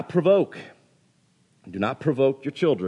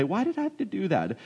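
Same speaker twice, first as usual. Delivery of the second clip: put-on high, softer child's question; happy obedient kid; 6 semitones higher, 5 dB quieter than usual. Child coming back to ask, happily curious. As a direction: ask sweetly like an imitated kid. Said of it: "If you happily come back and say".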